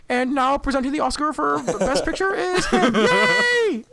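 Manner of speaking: high, loud froggy puppet voice; Kermit voice. Retold or imitated. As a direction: cheer like a puppet host. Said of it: "like, Kermit".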